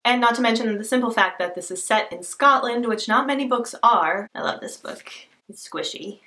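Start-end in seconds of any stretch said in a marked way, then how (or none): 4.3-6.2 soft whisper, almost to herself; whispered love. Real, not acted. Hugging her favourite big book, whispering softly.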